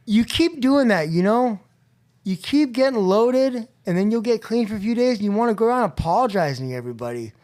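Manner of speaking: scolding big brother. Retold or imitated. Older brother scolding him for relapsing, fed-up and loud. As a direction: let him have it, exasperated.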